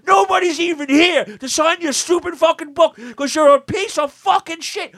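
high, loud, slow furious shouting; furious fan rant. Retold or imitated. Fan screaming at [name] after waiting three hours, furious high shout. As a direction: scream it at him, spitting every curse. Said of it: "The guy goes".

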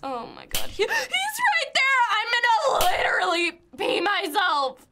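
panicked shrieks while being chased; panicking gamer. Real, not acted. Chased by Baldi in game.

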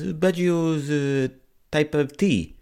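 slow, high mock Italian voice; mock Italian accent. The chipper owner answering about Baggio, slow mock Italian accent. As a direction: drag it out like a clueless chipper owner. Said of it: "He goes".

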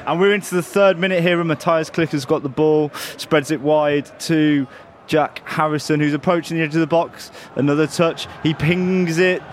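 building commentary; play-by-play. Pretend. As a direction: call the move as it builds.

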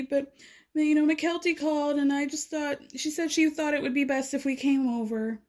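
a hint of an injured, self-justifying tone; imitating Robin. Recapping her confessional, lightly mimicking Robin's voice. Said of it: "Robin says in a confessional that".

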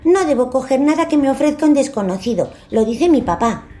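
put-on high little boy voice; child voice. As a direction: recite the rule like a small child. George refuses the stranger.

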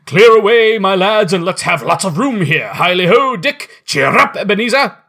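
loud, high, hearty boss calling; rally the lads with a jolly shout; jovial shout. A narrator's reading. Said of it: "cried old Fezziwig".

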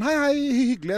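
high-pitched greeting. Retold or imitated. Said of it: "hun er bare sånn".

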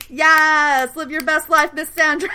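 high, loud, delighted whoop; gleeful cheering on. Real, not acted. Podcaster cheering on Sandra's new romance, gleeful. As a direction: cheer her on, breaking into a laugh.

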